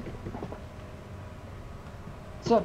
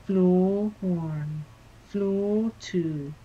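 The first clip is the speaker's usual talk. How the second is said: slow, drawn-out lift announcement, pitched up; Leicester Controls voice; 3 semitones higher, much slower than usual. Acted. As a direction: announce it like a dull recorded lift voice. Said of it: "There was Lester Control's voice".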